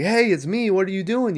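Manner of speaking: high-pitched retold protest; mock protest. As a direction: imitate someone urgently fending off an attack. Cotton protests being attacked, retold.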